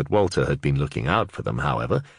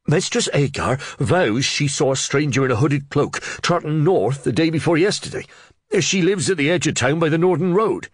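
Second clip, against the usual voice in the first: much higher, louder breathless blurting; excited Reeve; 10 semitones higher, 4 dB louder than usual. Acted. Flustered Reeve blurting news to the under-sheriff, much higher and louder. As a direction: tumble the news out, over-excited.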